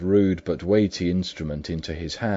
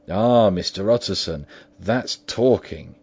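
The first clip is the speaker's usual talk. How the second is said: louder, relieved exclamation, slowing at the end; eager cry; 4 semitones higher, 8 dB louder than usual. A narrator's reading. Butler cheering the lawyer's resolve, eager and louder. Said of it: "cried the butler".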